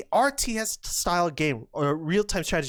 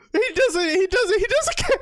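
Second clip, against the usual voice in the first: high squeal breaking into laughter; squeaky, laughing amazement; helpless laughter; 12 semitones higher than usual. Real, not acted. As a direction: crack up in disbelief while talking. Cracking up at player's audacity.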